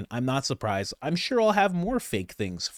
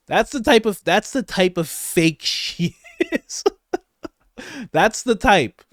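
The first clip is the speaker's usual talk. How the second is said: higher and louder, heated; exasperated rant; 10 semitones higher, 7 dB louder than usual. Real, not acted. Vlogger ranting about a fake campaign, loud and heated. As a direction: raise it up in disbelief.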